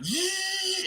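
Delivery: very high sustained squeal; long high-pitched "zzz"; imitated transformation noise. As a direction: make a piercing power-up whine.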